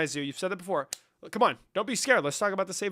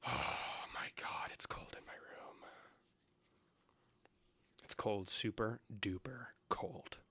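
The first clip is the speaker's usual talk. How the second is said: slow unvoiced whisper; a slow, hushed complaint about the cold; 6 semitones lower, 17 dB quieter, slower than usual. Real, not acted. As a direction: whisper it as if shivering.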